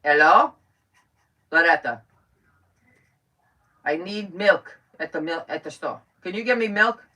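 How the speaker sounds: loud, accented impression of mother; mother's accent. Mother phones daughter for milk, imitated accent. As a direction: imitate an accented mother phoning, emphatic words. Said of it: "Then there's my mother".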